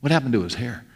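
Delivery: softly imitating gossip under the breath; hushed, half-whispered aside; mock whispering. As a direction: drop the voice like a furtive onlooker. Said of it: "they were all whispering under their breath".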